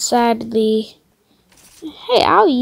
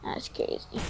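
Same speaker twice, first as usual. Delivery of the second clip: whispering; 11 semitones lower, 14 dB quieter than usual. Real, not acted. Whispered reaction.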